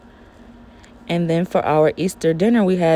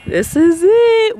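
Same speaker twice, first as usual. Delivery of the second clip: high, loud and slow reveal; excited; 12 semitones higher, 6 dB louder, slower than usual. Real, not acted. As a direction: announce it proudly. Showing off the new Jeep.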